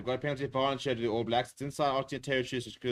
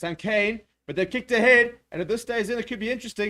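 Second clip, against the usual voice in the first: much higher and louder as the kick chases; excited call; 10 semitones higher, 8 dB louder than usual. Real, not acted. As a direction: build the suspense of the chase. Kick chase, ball near touch.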